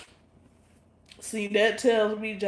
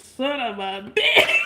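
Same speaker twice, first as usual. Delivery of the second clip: slow, louder, laughing through the curse; quoting the sketch, laughing; 4 semitones higher, 7 dB louder, slower than usual. Retold or imitated. Retelling the sketch to chat, a slow laughing mock outburst. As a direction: replay the teacher's outburst while cracking up. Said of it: "When he snaps the clipboard".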